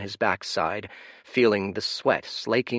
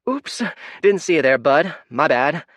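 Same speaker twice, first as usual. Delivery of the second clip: high, loud careless chirp; girl brushing off the old priest, carefree; breezy young girl; 6 semitones higher, 7 dB louder than usual. Acted. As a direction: toss off an apology without caring.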